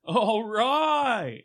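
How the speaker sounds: very slow, high "all right"; long stretched movie catchphrase; movie character entrance. Acted. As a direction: draw it way out, high. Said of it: "you're like".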